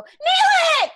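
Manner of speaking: very high, loud cry of recognition; delighted shout. Retold or imitated. Fan spotting the actor on screen, shouting his character's name. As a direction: squeal the name out at full voice. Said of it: "I go".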